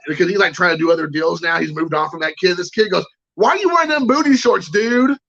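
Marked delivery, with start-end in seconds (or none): 3.3-5.2 put-on high-pitched indignant kid's demand; high, hammed-up outraged question; mock angry kid. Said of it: "he was angry about it".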